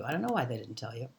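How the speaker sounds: quick, low, run-together repeat; fast casual speech. Shown as a demonstration. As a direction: rattle it off softly and fast. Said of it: "we say it so fast it's hard to hear".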